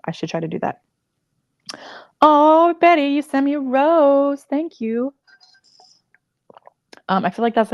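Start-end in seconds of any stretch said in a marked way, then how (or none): 2.2-5.1 higher, louder gasp of delight; thrilled by an unexpected gift; thanking a viewer for a rose, delighted; delighted surprise. Real, not acted. Viewer sends a gift live.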